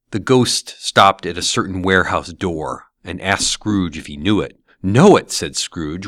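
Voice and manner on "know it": high, loud astonished cry; surprised. Acted. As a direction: burst out in recognition. Scrooge recognizing old workplace.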